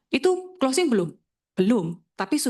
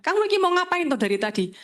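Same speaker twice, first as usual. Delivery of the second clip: put-on nagging girlfriend; 7 semitones higher than usual. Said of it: "pacar saya ngomel".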